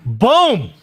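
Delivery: loud, high exclamation of vindication; gleeful shout of boom; triumphant boom. Real, not acted. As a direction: punch it out like a mic drop. Host reacts triumphantly to clip.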